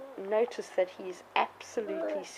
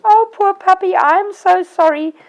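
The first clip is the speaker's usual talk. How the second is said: mock guilty apology; 8 semitones higher, 13 dB louder than usual. Shown as a demonstration. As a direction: gush guilt over a hurt puppy. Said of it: "feeling guilty and going".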